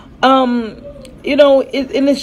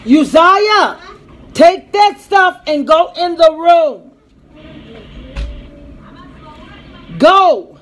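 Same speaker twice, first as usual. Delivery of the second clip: high, loud and slow order; firm shout; 8 semitones higher, 4 dB louder, slower than usual. Real, not acted. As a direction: bark the order and make them go. Mom ordering child again.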